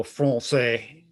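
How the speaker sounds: slow, hammed-up French français; overdone French accent. Shown as a demonstration. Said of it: "overdo it, you know, and go".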